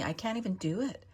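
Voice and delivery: ordinary talk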